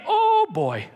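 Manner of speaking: put-on very high, dismayed "oh boy"; caught-out dread. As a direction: squeal in mock dread. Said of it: "she's probably leaving going".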